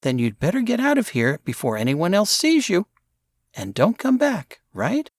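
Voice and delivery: a hint of a bossy girl warning; stern girl. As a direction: shoo them off, firm but light. Mary warning boys off.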